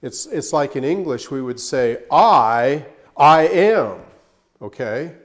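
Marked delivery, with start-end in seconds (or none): 2.1-4.0 loud "I, I am," with a push on both "I"s; emphatic I am. Shown as a demonstration. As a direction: make a big deal of the "I". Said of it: "we would be making a big deal about who I was".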